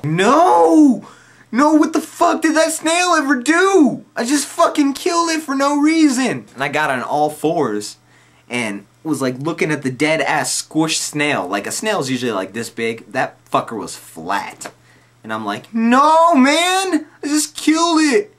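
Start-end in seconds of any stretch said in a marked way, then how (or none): 0.0-6.4 very high, loud mock sobbing; hammed-up wail. Stoned narrator wailing over the snail he crushed. 15.7-18.3 very high, loud mock wailing; crying my eyes out. Stoned narrator crying his eyes out over the snail. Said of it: "I'm crying my eyes out".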